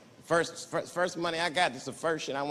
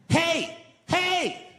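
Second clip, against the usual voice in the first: high, loud rowdy fan shout; fans yelling catchphrase; 8 semitones higher, 4 dB louder, much slower than usual. Retold or imitated. Park visitors shouting the catchphrase at the comic, loud. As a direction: bellow it at him like a fan.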